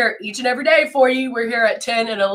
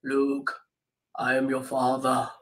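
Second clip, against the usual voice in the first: Darth Vader line; 7 semitones lower, 9 dB quieter than usual. Acted. Host with deep sick voice doing requested lines, mock deep villain. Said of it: "give me words to say, like".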